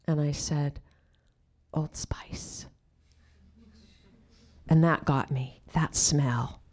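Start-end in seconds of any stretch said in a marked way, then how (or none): 1.7-2.7 near-whispered, much softer reply; hushed answer. Retold or imitated. Student answering acting teacher's question about her father, hushed. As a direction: let the words out under your breath.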